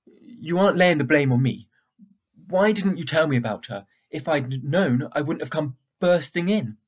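loud, high furious scolding; angry rebuke. Acted. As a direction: let her rip into him. Girl angrily blames him.

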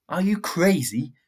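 loud, high, slow disbelieving shout. Acted. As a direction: yell it at him in disbelief. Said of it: "she shouted".

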